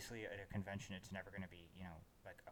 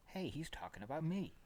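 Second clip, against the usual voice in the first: offended listener; 8 semitones higher, 4 dB louder than usual. Retold or imitated. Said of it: "somebody out there who would go".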